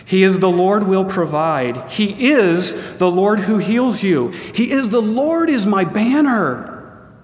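slow, ringing litany of divine names; solemn proclamation. Acted. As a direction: lift each name up like a herald.

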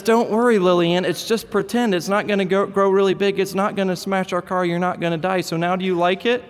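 put-on high, comforting little boy; a small boy soothing his scared sister. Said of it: "And he says".

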